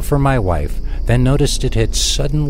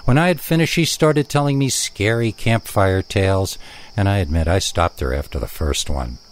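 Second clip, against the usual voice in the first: usual speaking voice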